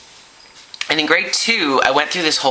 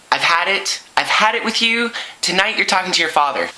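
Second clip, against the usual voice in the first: higher-pitched exasperated scolding; fed-up mom; 6 semitones higher than usual. Acted. Mom threatens son with father, fed up. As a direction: scold a child at the end of your patience.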